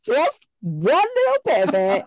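high laughing little plea. Real, not acted. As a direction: beg sweetly through laughter.

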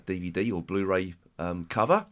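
ordinary talk

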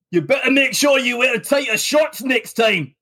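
high hammed-up shout from the scaffolding; mock shout. Andy shouts down to you from the scaffolding, hammed-up shout. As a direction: yell it down like a leering builder. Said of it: "Andy shouts down to you".

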